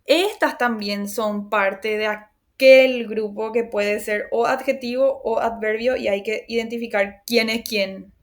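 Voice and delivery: ordinary talk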